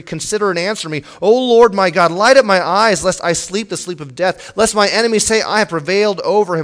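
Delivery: high, loud preaching of the psalm; preacher pleading through the psalm; fervent psalm reading. Acted. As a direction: proclaim the plea with rising fervour.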